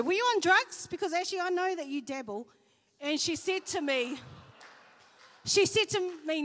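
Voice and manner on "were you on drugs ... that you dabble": high, loud mock disbelief; incredulous teasing. [name] ribbing her friend about drugs, incredulous. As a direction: ask it half laughing in disbelief.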